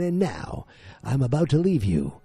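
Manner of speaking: high, loud announcer farewell, last word drawn out; hammed-up, swelling podcast outro; grand theatrical sign-off. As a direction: proclaim the show's closing catchphrase like a showman.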